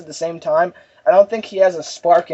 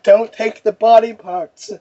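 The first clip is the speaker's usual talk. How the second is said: slow, higher pleading; cowering Optimus; 4 semitones higher than usual. Retold or imitated. Optimus cowering in corner, slow pleading to friends. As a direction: beg from the corner. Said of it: "he's like".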